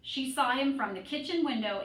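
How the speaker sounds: usual speaking voice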